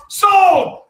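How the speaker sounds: high, loud triumphant shout; shouted sold. Real, not acted. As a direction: bang the gavel with your voice. Auctioneer closes the sale.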